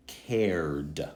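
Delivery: CARED very slowly, one syllable closing on d; plain d ending. Shown as a demonstration. Teacher giving examples of the d sound, slow d ending. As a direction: stretch CARED and release the d. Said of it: "simply like d".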